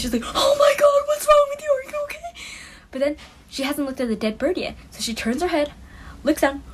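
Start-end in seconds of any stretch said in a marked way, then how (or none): 0.3-2.4 much higher shrieking scream, teary; friend screaming. Retold or imitated. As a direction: scream it like you just saw blood on her hand. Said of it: "and then she screams".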